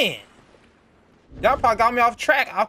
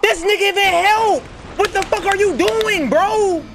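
angry yell; 9 semitones higher than usual. Real, not acted. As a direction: yell at the teammate who won't help. Frustrated gamer yelling at teammate.

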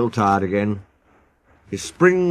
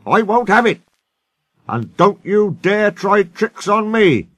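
high, emphatic warning; stern old engine telling them off; firm; 8 semitones higher, 3 dB louder than usual. Acted. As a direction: lay down the law indignantly. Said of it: "said Skarloey firmly".

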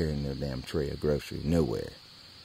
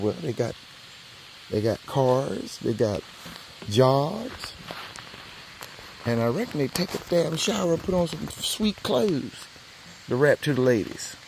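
grudging realization; 7 semitones higher, 5 dB louder than usual. Retold or imitated. Realizing why.

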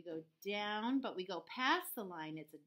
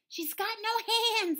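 high, loud, drawn-out bleat; sheep voice; 13 semitones higher, 9 dB louder than usual. Acted. Sheep explains for the cow, high bleating voice. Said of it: "explained the sheep".

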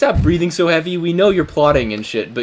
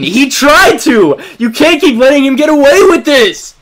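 angry; 8 semitones higher, 5 dB louder than usual. Real, not acted. Heated accusation to Brendan.

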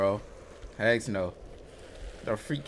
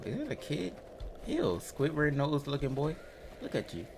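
normal talking voice

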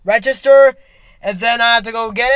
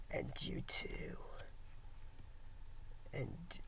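whisper; 10 semitones lower, 21 dB quieter than usual. Acted. Whispered aside to viewer.

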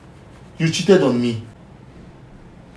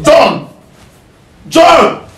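calling out; 6 semitones higher than usual. Real, not acted. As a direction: shout the name to get him. Calling out to John.

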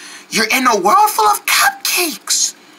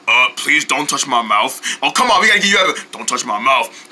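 low owl voice; 11 semitones lower, faster than usual. Acted. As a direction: grumble it deep and low.

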